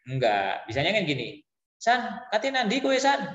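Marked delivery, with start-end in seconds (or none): 1.8-3.3 casual Javanese call to a friend, pitched high; everyday Javanese. Shown as a demonstration. Teacher shows pupils how friends ask in Javanese, casual and high. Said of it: "gitu kan biasanya bahasa Jawanya".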